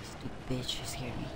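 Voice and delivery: unvoiced, muttered curse; whispered curse. Real, not acted. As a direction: hiss it under breath. Muttering after scare.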